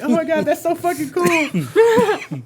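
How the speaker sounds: high, slow, laughing awe; excited gushing. Retold or imitated. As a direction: gush over it like a delighted fan. Gamer gushing over the game.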